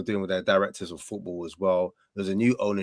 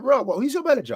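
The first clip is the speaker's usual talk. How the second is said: high, loud disbelieving friend; shocked pal asking who's managing Spurs, much higher; incredulous friend; 14 semitones higher, 3 dB louder, faster than usual. Pretend. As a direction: play the stunned mate asking who. Imagined friend reacts in disbelief.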